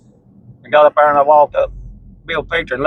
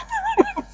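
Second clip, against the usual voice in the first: high laugh; 7 dB quieter than usual. Real, not acted. Laughs at his comeback.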